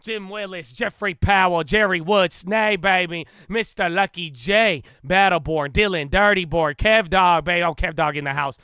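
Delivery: loud, punchy announcer greeting each name; hype shout-out roll call. Real, not acted. Hyped livestream roll call of viewers.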